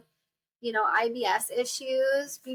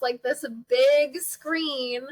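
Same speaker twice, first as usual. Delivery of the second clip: usual speaking voice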